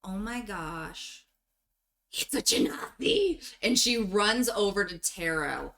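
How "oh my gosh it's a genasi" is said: excited. Acted. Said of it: "she is just the most energetic".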